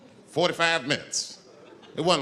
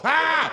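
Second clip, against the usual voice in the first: short, loud high cry; sudden high yell; 12 semitones higher, 5 dB louder than usual. Acted. Man reacting as the crowd charges, high cry. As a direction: burst out with a hammed-up yell. Acted moment of the climax.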